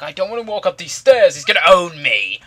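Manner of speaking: loud, high put-on complaint; mock whining protest. Game opponent refusing to climb the stairs, loud mock whine. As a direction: wail it like a hammed-up sore loser.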